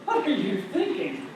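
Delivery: exasperated but finding it funny; fond mock scolding of toddlers; amused exasperation. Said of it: "I would hear myself say".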